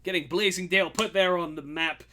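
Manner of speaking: loud, high announcer hype; showy mayor. Acted. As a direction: present the hero to the crowd. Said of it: "he's like".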